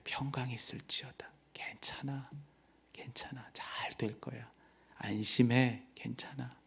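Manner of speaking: soft, hushed reassurance; Jesus calming his frightened disciples in a whisper; tender whisper. Acted. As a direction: breathe the comfort to frightened disciples. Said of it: "가장 따뜻한 그분의 음성을".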